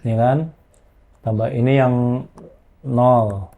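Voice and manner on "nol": ordinary delivery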